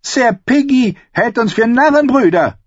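high, loud sneering voice; mocking. A narrator's reading. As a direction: sneer it out to the whole hall. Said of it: "spöttischem Gelächter".

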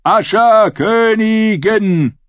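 high, long-drawn acclamation; rallying cry. A narrator's reading. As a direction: hail her with stretched final vowels. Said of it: "griff den Ruf auf".

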